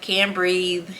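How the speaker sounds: ordinary talk, nothing marked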